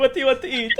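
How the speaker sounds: high cartoon waiter voice, a bit calmer; high comic waiter. Waiter asking again, high silly voice. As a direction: keep the silly voice going. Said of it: "not doing another voice again".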